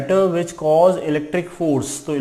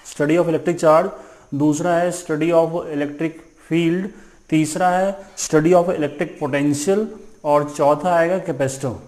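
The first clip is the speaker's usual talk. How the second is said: slow dictation; slower than usual. Shown as a demonstration. Dictating the chapter's topics.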